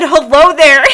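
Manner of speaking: high and loud through laughter; gleeful laughing quote of Obi-Wan. Retold or imitated. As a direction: giggle out the famous line. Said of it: "Obi-Wan said".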